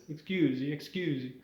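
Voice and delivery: demonstrating a stock Italian accent. Shown as a demonstration. Showing the way into an Italian accent, Italian accent. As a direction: say it in cartoon Italian. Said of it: "In Italian".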